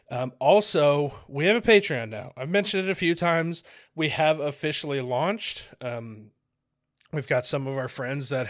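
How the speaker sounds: upbeat announcer read. Acted. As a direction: sell the Patreon, loud and bright. Podcast ad read, louder.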